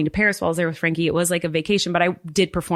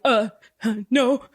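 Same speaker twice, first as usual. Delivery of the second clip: higher, halting nervous stammer; flustered mess; 5 semitones higher than usual. Retold or imitated. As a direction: stumble over an anxious excuse, backtracking. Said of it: "I was, like, just a mess".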